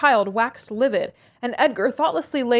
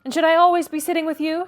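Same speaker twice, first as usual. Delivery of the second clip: high, louder irritated demand; 7 semitones higher, 4 dB louder than usual. A narrator's reading. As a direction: snap the question at him. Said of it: "she demanded, growing more irritated".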